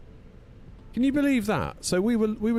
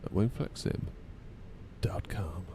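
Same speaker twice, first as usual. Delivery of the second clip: tossed-off whispered aside; 8 dB quieter, slower than usual. Real, not acted. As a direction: drop to a whisper for the web address. Sponsor plug trailing to whisper.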